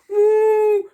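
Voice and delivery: high, loud woo; mammoth hoot. A narrator's reading. Mammoth joining the cheer, hooting. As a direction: hoot in triumph. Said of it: "hooted the mammoth".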